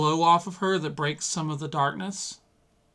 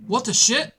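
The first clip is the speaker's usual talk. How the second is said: higher, louder startled yell; police officer yell; 8 semitones higher, 6 dB louder than usual. Acted. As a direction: shout in alarm at the dark. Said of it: "start to yell".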